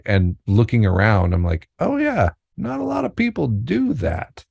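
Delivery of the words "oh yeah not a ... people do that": high, mock-surprised inner voice; playful high-pitched aside; mock realization. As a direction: pop up high as if suddenly noticing. Inner realization quoted with "I'm like.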